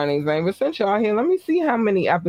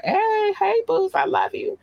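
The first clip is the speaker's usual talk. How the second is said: much higher put-on voice; squeaky greeting. Host greets her fans in a much higher playful voice. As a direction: chirp it up high like a cartoon.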